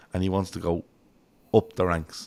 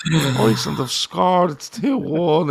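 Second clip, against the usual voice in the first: higher, louder, slow deflated announcement; mock glum pundit; 8 semitones higher, 6 dB louder, much slower than usual. Pundit telling the studio Iceland scored, mock deflated. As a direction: break the bad news slowly. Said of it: "And he goes".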